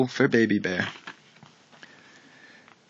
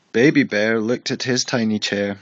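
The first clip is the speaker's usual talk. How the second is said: ordinary delivery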